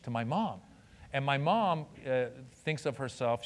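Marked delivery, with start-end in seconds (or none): none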